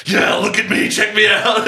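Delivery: high, loud, laughing cocky shout; smug self-admiring guy. Retold or imitated. Mocking Bateman cheering himself on in the mirror, loud and cocky. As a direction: crow at yourself like a vain show-off. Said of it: "he's just like".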